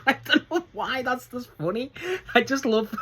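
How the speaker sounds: breaking into high giggles while talking; real laughter. Real, not acted. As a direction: still cracking up at the joke. Cracking up at the quote.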